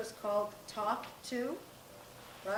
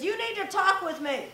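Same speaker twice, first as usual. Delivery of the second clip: mock parent, higher and louder, demanding; insistent parent demand; 8 semitones higher, 8 dB louder than usual. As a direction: press the kid to talk. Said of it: "when we say to our kids".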